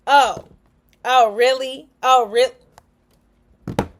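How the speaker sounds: loud sarcastic oh really; mock snippy reply. Imitating someone accused of attitude, loud and snide.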